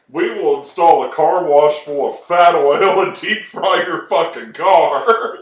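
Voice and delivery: loud, laughing mock menace; mock threat. Restaurant staff threatening a customer's car, laughing shout. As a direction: threaten like a crazed fry cook.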